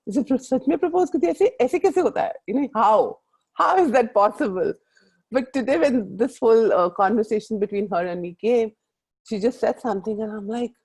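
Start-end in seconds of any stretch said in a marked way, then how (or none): 0.1-4.7 fast, high, rushing disbelief; incredulous excitement. Retold or imitated. Daughter baffled by movie proposals, gushing in disbelief. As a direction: pile on the astonishment at full speed. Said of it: "I said".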